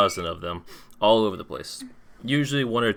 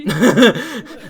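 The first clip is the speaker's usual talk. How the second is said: big high burst of laughter; loud laugh; 14 semitones higher, 11 dB louder than usual. Real, not acted. GM laughs at rowdy scene.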